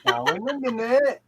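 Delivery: high, lilting mock Welsh; Welsh accent. Friend copying the Welsh catchphrase, high Welsh lilt. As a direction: echo it in a high Welsh singsong. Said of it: "you've got to do a Welsh accent".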